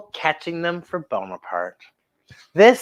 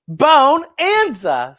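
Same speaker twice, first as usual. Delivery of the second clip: character voice; 9 semitones higher, 3 dB louder, much slower than usual. Acted. Character's pun in story.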